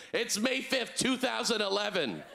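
high-pitched mock wake-up call; hotel wake-up caller.

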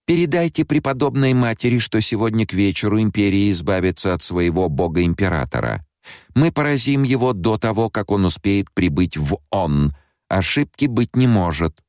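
high squeaky voice through the nose; squeaky nasal voice. A narrator's reading. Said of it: "писклявым голосом, произнося гласные немного в нос".

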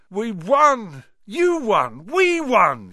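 high, loud cheering shout; Ron shouting. A narrator's reading. Ron shouting joyfully at Harry after the match. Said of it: "shouted Ron".

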